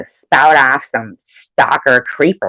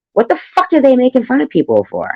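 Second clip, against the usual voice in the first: indignant; 11 semitones higher than usual. Real, not acted. Indignant rant.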